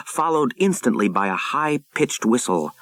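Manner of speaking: plain reading voice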